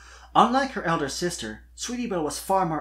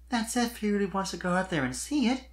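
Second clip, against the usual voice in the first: put-on young mare voice; 7 semitones higher than usual. Sweetie Belle's voiced lines.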